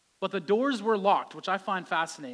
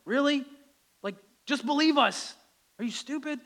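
higher, incredulous teasing; mocking Peter; 7 semitones higher, 4 dB louder than usual. Peter teasing doubting Thomas, higher incredulous mock. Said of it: "Peter's like".

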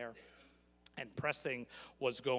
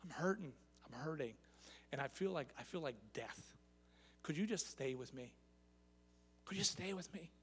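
hurting plea; 5 dB quieter than usual. Retold or imitated. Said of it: "what he's saying is".

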